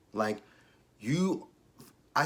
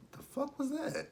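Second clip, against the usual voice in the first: much higher, hushed "the fuck was that"; startled; 8 dB quieter than usual. Real, not acted. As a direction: jump at a strange noise. Startled by a sudden noise.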